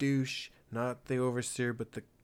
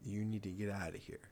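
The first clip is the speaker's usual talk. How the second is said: ordinary talk, nothing marked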